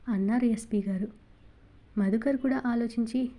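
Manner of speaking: usual speaking voice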